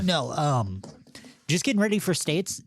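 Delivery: normal talking voice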